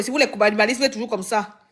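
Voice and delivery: usual speaking voice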